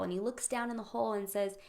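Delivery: normal talking voice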